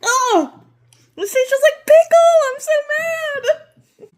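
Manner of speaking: loud, high mock outrage; excited outburst. Real, not acted. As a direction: shriek it in disgusted delight. Bites pickle candy, mock outrage.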